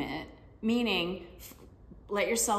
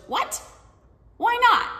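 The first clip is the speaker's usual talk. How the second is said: loud, much higher, outraged what and why not; indignant surprise; 9 dB louder than usual. Retold or imitated. Mom protesting to her daughter, loud shocked outrage. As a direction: squawk it, caught off guard and affronted.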